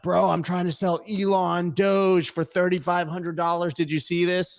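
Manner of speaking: high, excited friend on the phone. Retold or imitated. Friend calling the host about fake prices, excited. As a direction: pitch up like you just got rich. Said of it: "A friend of mine was like".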